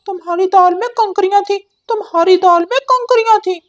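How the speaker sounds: high, bright cartoon parrot voice; talking parrot; chatty parrot. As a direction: squawk it out like a cheeky parrot bird. Parrot taunts.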